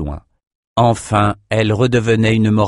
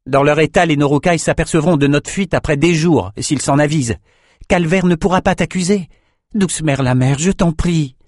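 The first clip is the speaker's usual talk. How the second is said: young swordsman coaxing, voiced higher; Bannon pleading; 6 semitones higher than usual. A narrator's reading. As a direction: coax the old cook gently but urgently. Bannon urges Emmett.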